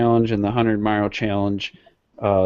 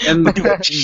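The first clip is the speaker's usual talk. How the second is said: laughing; 13 semitones higher, 3 dB louder than usual. Real, not acted. Bursts out laughing.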